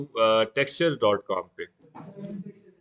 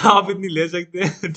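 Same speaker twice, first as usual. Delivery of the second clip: laughing; 5 semitones higher, 7 dB louder than usual. Real, not acted. Laughing at teacher's joke.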